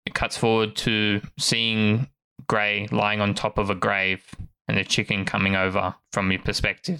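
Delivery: usual speaking voice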